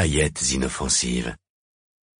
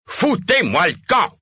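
furious high-pitched yell; 4 dB louder than usual. A narrator's reading. Mayor driving the audience out, yelling. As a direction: yell them out of the hall. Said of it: "hurla le maire".

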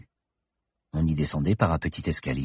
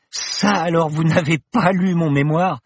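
put-on indignant young woman; 10 semitones higher, 5 dB louder than usual. Paloma indignant at Bergton.

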